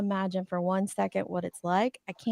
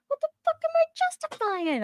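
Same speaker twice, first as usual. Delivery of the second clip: exasperated outburst; much faster than usual. Real, not acted. Streamer to chat accusing her of justifying, exasperated high outcry. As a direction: pitch leaps way up in disbelief.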